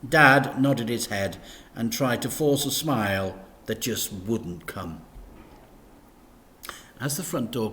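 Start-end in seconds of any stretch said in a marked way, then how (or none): none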